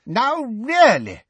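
slow, high-pitched fretting; anxious. A narrator's reading. Professor losing control of the exam, anxious. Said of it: "said Professor Tofty anxiously".

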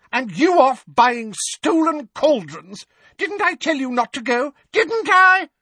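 put-on high, loud scolding old woman. A narrator's reading. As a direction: nag him hard, rising. Mrs Figg scolding.

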